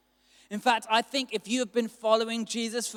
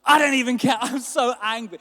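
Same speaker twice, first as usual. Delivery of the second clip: mock angry outburst; 3 semitones higher, 7 dB louder than usual. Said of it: "I was just like".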